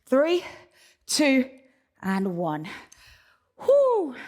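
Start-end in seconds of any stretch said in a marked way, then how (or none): 3.6-4.2 high exhale of relief after the set; relieved whew. Real, not acted. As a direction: blow out hard, spent. Set just finished.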